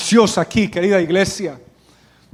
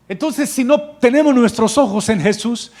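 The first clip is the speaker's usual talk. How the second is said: ordinary delivery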